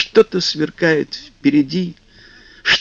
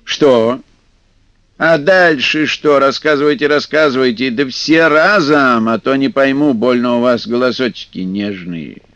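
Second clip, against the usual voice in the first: stern. Acted. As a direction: grill them sternly like a grumpy elder. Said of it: "сурово спросил".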